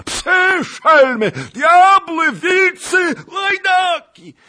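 very high, full-force shouted abuse; roared curses. A narrator's reading. Nobleman cursing the pursuing Tatars, furious roar. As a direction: hurl each insult as a separate yell. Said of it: "ryknął Zagloba".

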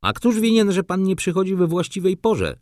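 high, loud indignant yell. A narrator's reading. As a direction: yell it back at him, furious. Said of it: "wrzasnął młody człowiek".

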